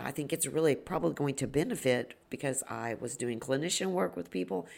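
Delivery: ordinary talk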